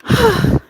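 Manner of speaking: high, loud lovesick "ugh"; loud swoony groan. Real, not acted. Gushing to Christine about the accent with a lovesick groan. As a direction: melt with a swoon.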